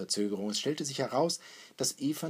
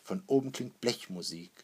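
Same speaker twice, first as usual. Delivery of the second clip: ordinary delivery, nothing marked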